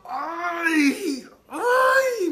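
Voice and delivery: high, loud cries of pain; pained cry. Retold or imitated. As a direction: yelp like the laser is burning you. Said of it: "le hacía así". (gritos agudos de dolor)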